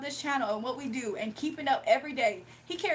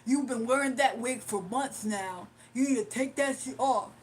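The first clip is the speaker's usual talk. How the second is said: out-of-breath caller; 2 semitones lower than usual. Retold or imitated. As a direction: imitate the caller laboring through his insult. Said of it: "That's exactly how he talks".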